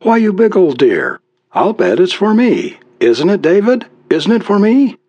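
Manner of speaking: high-pitched affectionate wife; fond, coaxing wife. A narrator's reading. Wife guesses the poem is hers, fondly coaxing. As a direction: coo hopefully in a high voice.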